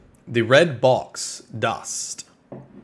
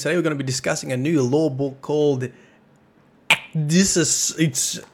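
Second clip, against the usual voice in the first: ordinary delivery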